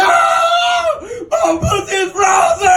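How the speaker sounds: very high, drawn-out shriek; screaming in shock. Retold or imitated. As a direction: wail it as if hit by ice. Retold scream at the ice.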